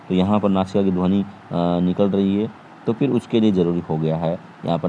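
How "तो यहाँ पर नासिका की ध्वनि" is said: ordinary talk, nothing marked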